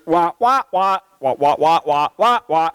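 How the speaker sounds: muffled trombone-like wah wah; Charlie Brown's teacher. Retold or imitated. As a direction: mimic a garbled teacher voice, wah wah. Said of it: "sound like Charlie Brown's teacher".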